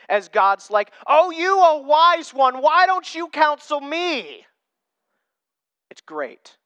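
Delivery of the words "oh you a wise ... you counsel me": slow, high, loud mock admiration; dripping sarcasm. God mocking Job as the wise one, dripping sarcasm. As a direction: lay the sarcasm on thick, drawing out each word. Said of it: "the sarcasm is just dripping off of it".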